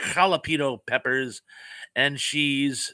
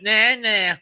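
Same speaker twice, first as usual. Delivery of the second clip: a sing-song mock "Nah, nah", higher and louder; taunting nah nah; 8 semitones higher, 6 dB louder than usual. Reading a viewer's gloating comment in a mock taunt. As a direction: tease like a kid who got there first.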